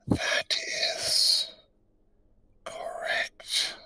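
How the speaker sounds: whispered, bubbling riddle voice; underwater gurgling voice. Acted. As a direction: gurgle the words through bubbles. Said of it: "I do bubbles".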